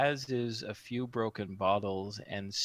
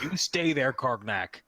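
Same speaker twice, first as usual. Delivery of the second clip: loud orc ordering the cheater to stay, pitch jumping high; orc bossing the cheater; 3 semitones higher, 3 dB louder than usual. Acted.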